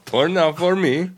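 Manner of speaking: high mock Russian accent; high Russian accent, playful. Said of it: "Russian accent talk".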